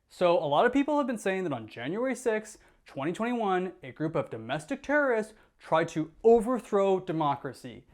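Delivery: ordinary talk, nothing marked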